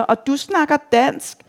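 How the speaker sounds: high, mildly shouted question. A narrator's reading. Reveller into a Thai woman's ear, shouting a question. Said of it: "råber ind i hendes ører".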